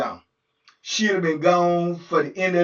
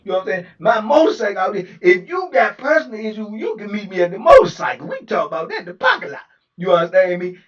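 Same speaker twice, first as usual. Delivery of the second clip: louder, hard challenging tone; mock gangster threat; 4 semitones higher, 9 dB louder than usual. Booker challenging the lieutenant to the parking lot, loud. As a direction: dare him to meet outside.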